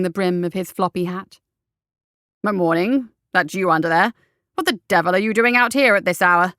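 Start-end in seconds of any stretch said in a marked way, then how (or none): 2.5-6.5 a hint of a gruff officer, a bit louder; hearty military captain questioning; lightly voiced bluff captain. A narrator's reading. Captain questions the doctor at the gate: lightly voiced bluff officer.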